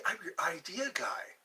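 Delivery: conspiratorial whisper to the chat. Pretend. Streamer whispering his pitch to viewers. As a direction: lean in and breathe it secretly. Sly aside about being idea guy.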